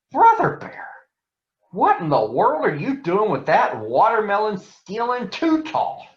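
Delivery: high startled cry of disbelief; surprised farmer. Acted. Farmer catching cub in the cornfield, shocked high cry. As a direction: catch him red-handed, shocked.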